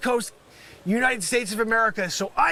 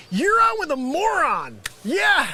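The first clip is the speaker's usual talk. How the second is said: mocking holler; 6 semitones higher, 4 dB louder than usual. Mimics coworkers jeering at the caller.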